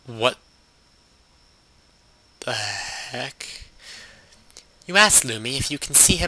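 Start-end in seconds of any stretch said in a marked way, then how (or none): none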